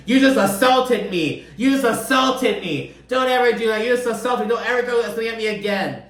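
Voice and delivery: high-pitched imitation of an outraged yell; retold yelling. Host quoting upset castmate after the tossed juice box, yelling. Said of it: "starts yelling".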